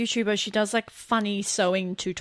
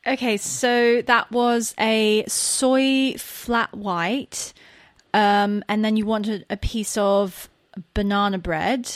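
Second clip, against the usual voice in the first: slow, measured British accent; slower than usual. Shown as a demonstration. Barista reading back a coffee order, British accent. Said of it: "Read back a coffee order in a British accent".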